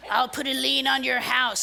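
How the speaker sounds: lightly voiced bully contractor, patronizing; condescending roofer. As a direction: talk down to her, then threaten. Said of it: "He really did call me little girl".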